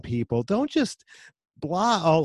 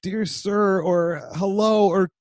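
exasperated dad; 8 semitones higher, 6 dB louder than usual. Retold or imitated. Dad scolding his son about a casual email, high and loud. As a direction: lecture him loud and high, half laughing. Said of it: "and I'm like".

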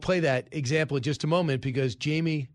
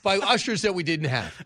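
louder, higher, talking through real laughter; laughing; 4 semitones higher, 4 dB louder than usual. Real, not acted. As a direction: laugh your way through the words. Laughs at own story.

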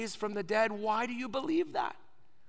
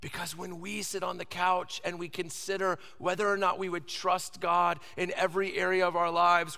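normal talking voice

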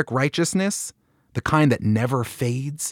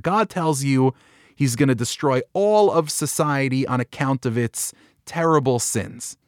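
ordinary delivery

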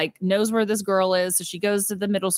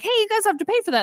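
high mock clerk calling after them; mock store clerk; 13 semitones higher, much faster than usual. Clerk calling after shoplifters, high mock voice. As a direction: call them back like a clerk, pitched high.

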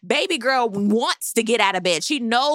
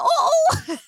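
high, loud-edged hooting ooh; scandalized ooh; 4 dB louder than usual. Retold or imitated. Recapper replays own reaction to Gizelle's jab, high scandalized ooh. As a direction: let out a high, gleeful mock gasp of "ooh".

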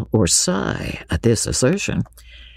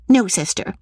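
high-pitched protest to a sister; Lucy crying out; 11 semitones higher than usual. A narrator's reading. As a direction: contradict her in Lucy's high voice. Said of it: "cried Lucy".

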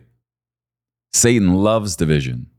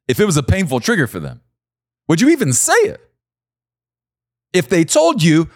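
rising preaching intensity; 10 semitones higher, faster than usual. Real, not acted.

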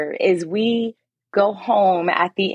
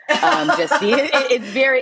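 laughing; 7 semitones higher than usual. Real, not acted. Laughing while talking.

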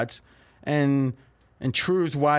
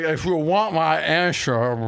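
put-on slow, slurred drunk mob boss; drunk Michael Corleone; 2 semitones higher, slower than usual. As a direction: drag the words like a drunk don. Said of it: "that sounded like a drunk Michael".

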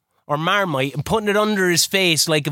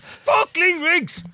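mock outrage; 11 semitones higher, much slower than usual. Mock outburst at friend.

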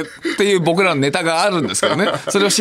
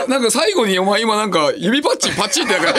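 ordinary talk

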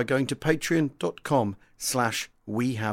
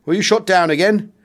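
louder, higher ribbing question from a cheery pilot; hearty teasing; 6 semitones higher, 10 dB louder than usual. Acted. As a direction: toss the jibe out with good-humoured gusto. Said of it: "he proclaims heartily".

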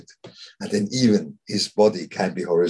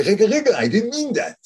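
high, louder, laughing protest; mock protest; 13 semitones higher, 4 dB louder than usual. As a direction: squeal it like a flustered man caught out. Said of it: "You say".

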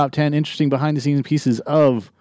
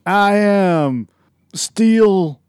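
fighting game announcer; 8 semitones higher, 6 dB louder, much slower than usual. Acted. Announcer-style name reveal.

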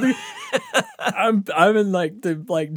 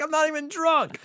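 much higher, pleading whine; whiny protest. Retold or imitated. Teen protests to the cops, whining. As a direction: plead like a kid caught.

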